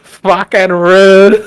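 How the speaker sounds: imitating an Aussie driver's outrage, Australian accent; mock Australian accent. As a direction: stretch it out in a broad Australian accent. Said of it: "Australian accent is great".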